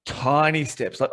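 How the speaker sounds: ordinary delivery, nothing marked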